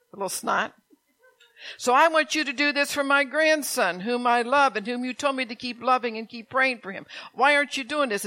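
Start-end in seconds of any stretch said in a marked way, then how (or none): 1.8-8.3 raised, higher pleading outcry; a loud, higher, anguished plea to God; pleading cry to God. Retold or imitated. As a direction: cry out to God, demanding why. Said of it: "And so I said".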